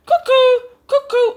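very high loud cuckoo; cuckoo call. Acted. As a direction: chirp it like a cuckoo clock. Clock in the living room.

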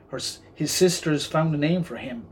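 usual speaking voice

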